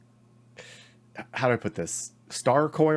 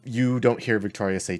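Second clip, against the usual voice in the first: ordinary talk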